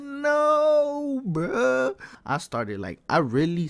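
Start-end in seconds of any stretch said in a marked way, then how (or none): 0.0-1.9 high, loud drawn-out retold protest; hammed-up cry of disbelief; mock dismay. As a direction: wail it like you've been caught. Retelling horror at being caught.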